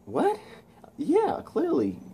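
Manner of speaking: put-on high-pitched voice for a girl character; imitating Gwen. Gwen answering Peter in disbelief, high put-on voice. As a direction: squeak it up high, drawing out the answer. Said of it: "And Gwen's like".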